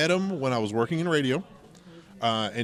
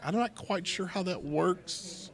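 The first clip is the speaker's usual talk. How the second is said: slow, higher, bemused musing; puzzled inner voice; 7 semitones higher, 5 dB quieter, slower than usual. Retold or imitated. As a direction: wonder it aloud, baffled. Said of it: "you're like".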